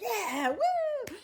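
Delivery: high, loud whoop with a laugh; laughing victory whoop; excited cheer. Pretend. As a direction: cheer like your team just won. Favorite team wins, cheering.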